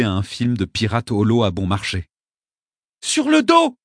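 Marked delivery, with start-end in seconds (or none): 3.0-3.8 put-on high, loud urgent little girl; girl shouting. A narrator's reading. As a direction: shout the order again. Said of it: "répéta la fillette".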